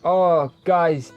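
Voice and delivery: ordinary talk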